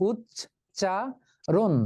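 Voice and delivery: উচ্চারণ slow, উচ্-চা-রণ each beat apart; teacher drawing out উচ্চারণ syllable by syllable; syllables split. Shown as a demonstration. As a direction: tap out উচ্চারণ in three beats. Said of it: "অক্ষর আছে তিনটি".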